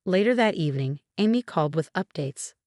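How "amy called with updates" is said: ordinary delivery, nothing marked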